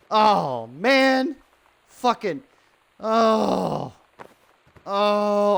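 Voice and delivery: high, strained exclamations; startled groans. Real, not acted. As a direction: yelp in dismay. Streamer startled and frustrated.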